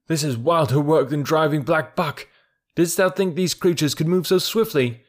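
eager calls over galloping cattle; calling out excitedly. Acted. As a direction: cheer them on mid-chase. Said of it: "Mowgli called".

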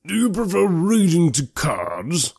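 slow, high-pitched incredulous question; astonished. A narrator's reading. Mister Hurst to Elizabeth over cards, astonished. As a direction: draw it out in an astonished character voice. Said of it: "looked at her with astonishment".

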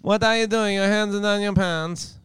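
put-on high Borat accent. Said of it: "hard not to do the accent again".

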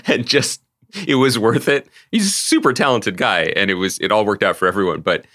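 ordinary talk

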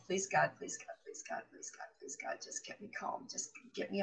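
breathless whispered plea to God; whispered prayer. Retold or imitated. Praying silently in the car beside him, whispered. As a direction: pray under your breath. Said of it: "I'm just going".

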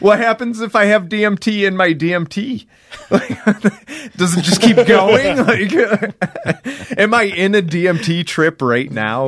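laughing, pitched high, mind racing; giggly freak-out; real laughing worry about an endless trip. Real, not acted. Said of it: "I started freaking out".

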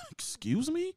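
high-pitched incredulous question; mock disbelief. Reacting to a seller's steep price, mock disbelief. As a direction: query it in shocked falsetto.

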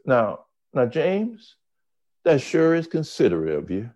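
lightly acted courteous host, pitched high; mock-genteel politeness; polite Southern liberal. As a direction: imitate a politely condescending gentleman. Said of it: "they will be polite to you".

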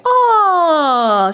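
loose yawn sliding downward; relaxed yawn. Shown as a demonstration. Coach modelling a relaxed yawn sound. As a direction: release a lazy yawn. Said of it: "Just a really relaxed yaw".

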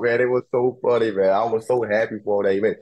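ordinary talk

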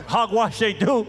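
preacher hammering a refrain; preaching. Acted. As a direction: drive the point home to the congregation. Preaching at full force.

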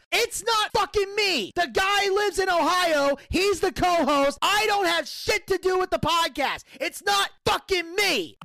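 high-pitched, emphatic exasperated rant; retold angry screaming. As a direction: hammer each phrase in furious protest. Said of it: "this is how mad I was".

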